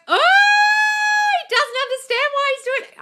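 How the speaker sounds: very high, loud, excited shriek; delighted squeal. Real, not acted. Coach thrilled at a follower's success, delighted squeal. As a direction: squeal it out in gleeful amazement. Thrilled by viewer's manifestation news.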